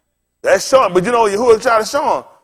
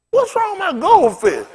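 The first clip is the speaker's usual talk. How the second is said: high worried question; alarmed; 10 semitones higher than usual. Retold or imitated. Finding the goldfish, high and worried. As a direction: cry out over the fish. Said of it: "I was like".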